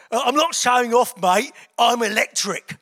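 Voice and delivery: very loud imitation Cockney; Cockney accent. Passing biker to struggling cyclist, loud mock Cockney accent. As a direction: call it out in broad Cockney. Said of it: "in a real Cockney accent".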